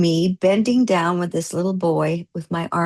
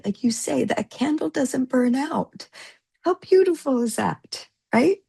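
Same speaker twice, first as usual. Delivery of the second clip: high, tearful voice fighting tears; pushing words out through real tears; high and tremulous from real tears; choked-up tears; 7 semitones higher than usual. Real, not acted. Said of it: "Oh, I got you going now".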